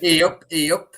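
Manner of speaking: gruff football manager catchphrase, pushed out loud; loud, comic manager impersonation; Mick McCarthy impression. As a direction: punch out a cartoonish northern greeting. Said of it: "Mick McCarthy impression".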